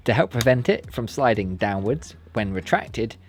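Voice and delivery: usual speaking voice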